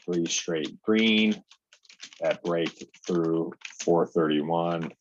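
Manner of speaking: slow, spaced out, word by word like taking notes; slow dictated recap. Acted. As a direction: spell out the doji hammer slowly, as if dictating.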